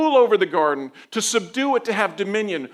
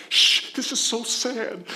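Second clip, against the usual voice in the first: hushing family member; 3 semitones higher, slower than usual. Acted. Family member hushing during sad show.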